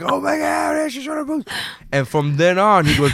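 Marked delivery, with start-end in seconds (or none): 0.0-1.5 high shouted oh my god; excited shout. Retold or imitated. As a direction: squeal it with shock. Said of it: "everybody was like".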